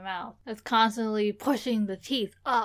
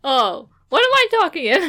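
mock surprise; 10 semitones higher, 6 dB louder than usual. Mock shock, laughing.

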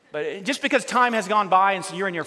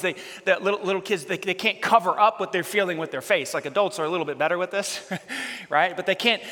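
ordinary talk, nothing marked

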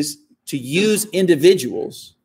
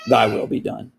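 lower, solemn voice of God pronouncing judgment; God answering; 6 semitones lower than usual. Retold or imitated. God turning the prayer back on the one who refused him, low and grave. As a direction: hand down the words as God, low and final. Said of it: "and those to whom God says".

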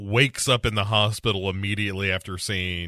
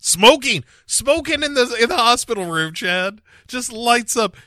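delighted; 11 semitones higher, 3 dB louder than usual. Real, not acted. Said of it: "Love it".

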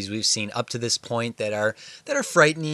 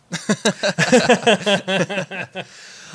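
long, high, loud laugh; 9 semitones higher, 5 dB louder than usual. Real, not acted. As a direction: burst out laughing at your own joke. Laughs after joking about a trope.

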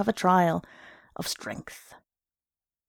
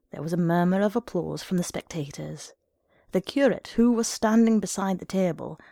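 ordinary delivery

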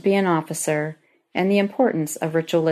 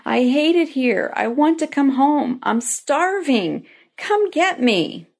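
higher-pitched mock wail, stretched out; retold tearful plea, pitched up and drawn out; imitating a sobbing daughter; 8 semitones higher than usual. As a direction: plead like a homesick daughter on the phone. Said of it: "I received a sobbing phone call".

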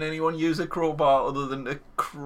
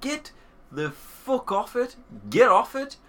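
exasperated rant; 7 semitones higher than usual. Real, not acted. Friend fed up with Brexit talk, exasperated rant. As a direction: vent your frustration at politicians.

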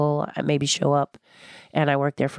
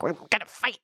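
strained, higher mock-combative voice; mock fighting intensity; 4 semitones higher, 4 dB quieter than usual. Woman describing heated talks with husband, mock combative. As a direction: square up for a fight in the voice. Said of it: "my face changes, and my body changes".

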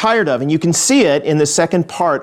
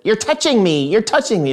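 high, falling mock whine; childish complaint, pitched up; whining sister; 7 semitones higher than usual. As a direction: imitate an irritated kid sister tattling. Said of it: "she says it".

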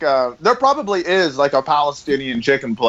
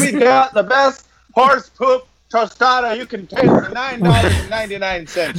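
higher, hammed-up TV pitchman; mock ad read; 7 semitones higher than usual. Joking on air about the sponsor, a hammed-up pitchman voice. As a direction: sell the horse poop tostada like a cheesy local commercial.

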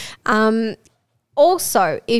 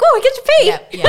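excited; 6 dB louder, much faster than usual. Retold or imitated. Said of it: "I'm like".

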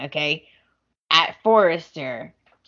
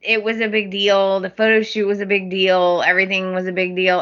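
ordinary talk, nothing marked

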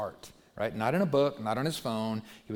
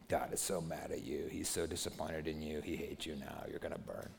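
much softer, lower menacing murmur; condemning inner voice; 9 semitones lower, 11 dB quieter than usual. Retold or imitated. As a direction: drop to a dark hushed voice of condemnation. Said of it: "some voice in your head is saying".

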